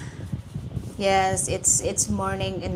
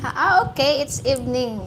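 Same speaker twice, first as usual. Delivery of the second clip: normal talking voice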